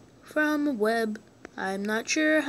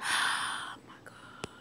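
sighing, slow whisper; exasperated whisper; 13 dB quieter, slower than usual. Real, not acted. Gamer sighing in disbelief at a loss. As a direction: breathe it out defeated.

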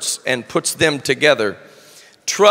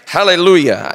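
usual speaking voice

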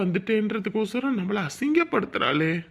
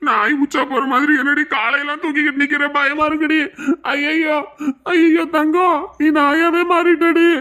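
comic fright; 5 semitones higher, 5 dB louder than usual. Acted. Husband frightened of dog-wife.

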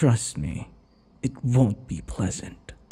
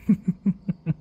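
teasing laugh; 9 semitones higher than usual. Acted. After calling him vampire.

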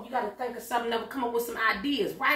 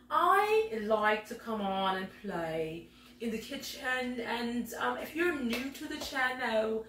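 higher, slow, put-on genteel hostess voice; a prim, higher hostess voice for the coffee-time show; fancy lady character; 4 semitones higher, slower than usual. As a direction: play the proper tea-party lady welcoming her guests. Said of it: "we're gonna get back into character".

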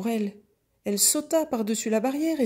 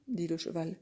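ordinary delivery

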